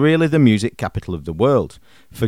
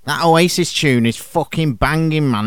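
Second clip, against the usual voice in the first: Manchester accent, pitched up; lad's Manc greeting; 4 semitones higher than usual. Shown as a demonstration. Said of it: "listen to their equivalents in Mank dialect".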